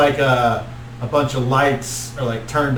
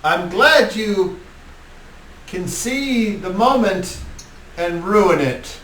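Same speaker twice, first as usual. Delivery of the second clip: a touch higher, slow, dry sarcasm; lightly voiced Strahd; 7 semitones higher, slower than usual. Strahd chiding the giggling lackey, lightly voiced and dry. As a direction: let a hint of mock disdain drip out slowly.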